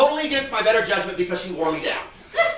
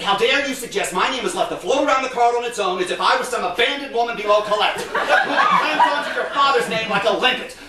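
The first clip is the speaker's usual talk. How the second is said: affronted mother, rising in pitch and force; scandalised and aggrieved; indignant outrage. Acted. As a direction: build indignation to an outraged finish. Outraged mother's reply on phone.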